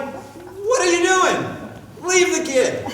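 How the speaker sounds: loud, high, drawn-out rebuke; hammed-up scolding of the fussing parent, slow; mock exasperated shout. Onlooker scolding a hovering parent, slow loud mock shout. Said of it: "It's like going".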